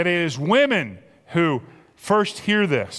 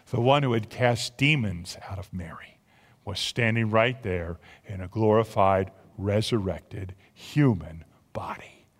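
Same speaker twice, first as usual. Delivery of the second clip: hushed awe; 9 semitones lower, 5 dB quieter than usual. Acted. Preacher tells the resurrection moment, hushed. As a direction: let the wonder hush your voice.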